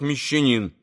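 ordinary delivery

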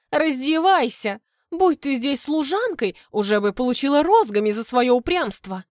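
imperious telling-off; scolding command. A narrator's reading. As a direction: order her about with sharp impatience. Марта командует героине в ссоре.

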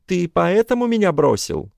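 high indignant woman's voice; ex-wife voice. Acted. Ex-wife's texts voiced.

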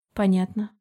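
ordinary delivery, nothing marked

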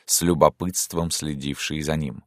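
ordinary delivery

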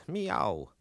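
a lazy drawn-out meow; a falling meow; cat meow. Acted. Cat narrator meows.

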